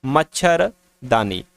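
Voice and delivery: मच्छरदानी drawn out slowly, syllable by syllable; teacher stretching मच्छरदानी so pupils hear each part; slow word demo. Shown as a demonstration. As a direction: spell out मच्छरदानी slowly for the class. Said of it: "जैसे कि एक शब्द होता है मच्छरदानी".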